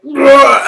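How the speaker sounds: high, loud "ugh"; sudden loud noise of alarm; retold panicked grunt. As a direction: burst out a mock panicked ugh. Reacting to a possible loose rivet.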